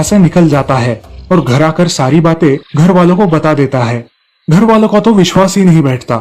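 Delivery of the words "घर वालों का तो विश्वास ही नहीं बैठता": ordinary delivery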